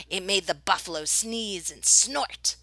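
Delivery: ordinary delivery, nothing marked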